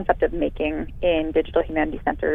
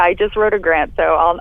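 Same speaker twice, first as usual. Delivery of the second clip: normal talking voice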